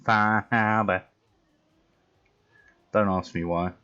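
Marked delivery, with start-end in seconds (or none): 0.0-1.0 very slow, drawn-out Boston accent, Harbor as Hahbah. Shown as a demonstration. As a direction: stretch it out in a thick Boston accent. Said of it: "I can only say one thing with a, like, a Boston accent".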